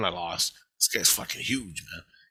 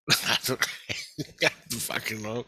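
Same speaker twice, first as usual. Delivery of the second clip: laughing. Real, not acted. Laughs while telling the story.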